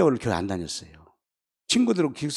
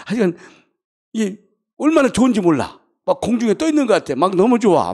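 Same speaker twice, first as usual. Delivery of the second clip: put-on giddy drunken delight; 7 semitones higher, 7 dB louder than usual. Recalling the drunken high.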